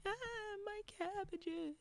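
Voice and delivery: high, slow, wailing "my cabbages"; a hammed-up cry over ruined cabbages; cabbage merchant wail. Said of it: "this little pig is definitely saying".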